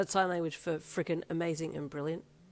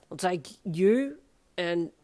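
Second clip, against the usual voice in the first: put-on drunk slurring; 6 dB louder, slower than usual. A narrator's reading. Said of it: "Darby slurred".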